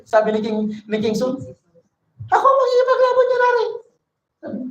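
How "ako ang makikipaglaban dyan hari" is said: fast, much higher, eager volunteer; eager bold offer. Acted. David eagerly volunteering to King Saul, fast and high. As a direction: blurt it out, bursting to go.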